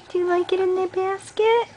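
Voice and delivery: high coaxing repeat. Real, not acted. Parent repeats question, much higher.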